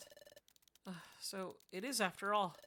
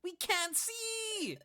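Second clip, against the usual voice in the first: higher, slow wail; blinded panic; much slower than usual. Acted. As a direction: cry out, dazzled and lost. Blinded by flash.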